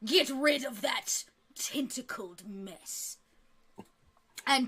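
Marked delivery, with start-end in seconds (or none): 0.1-3.2 slow, hushed royal order; commanding king. Acted. King ordering guards to remove Gerdurgit, slow hushed command. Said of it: "He says".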